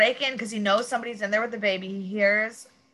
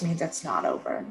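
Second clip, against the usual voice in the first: ordinary delivery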